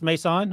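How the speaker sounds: Mason said as "may-SAHN", rising in question; questioned pronunciation. Shown as a demonstration. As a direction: stretch Mason's ending into an open "sahn". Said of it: "Is this first name pronounced".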